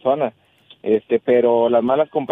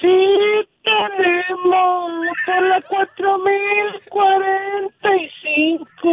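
high, slow mimicked parrot squawk; parrot impression; much slower than usual. Caller imitating the parrot for the host, high squawk. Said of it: "a ver si puedes remedar mi voz".

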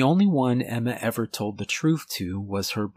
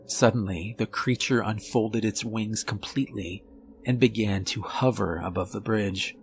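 ordinary delivery, nothing marked

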